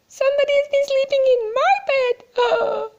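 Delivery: a little cub wailing about his bed; high, squeaky, near-crying voice; squeaky Baby Bear. Acted. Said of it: "squeaked Baby Bear".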